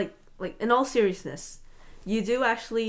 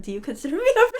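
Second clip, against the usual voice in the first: high, needy question through a laugh; louder, higher, laughingly unsure; insecure; 6 semitones higher, 5 dB louder, much faster than usual. Real, not acted. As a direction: ask for reassurance, half laughing at yourself. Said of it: "You sound so insecure right now".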